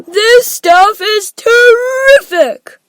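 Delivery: high, loud and drawn-out delight; thrilled relish. A narrator's reading. Augustus relishing the chocolate, loudly thrilled. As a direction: stretch it out with greedy glee.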